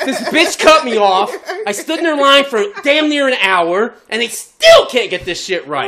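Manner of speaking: venting, half laughing; pissed rant. Retold or imitated. Ranting alone in the car about the wrong order, pissed. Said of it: "I'm just pissed".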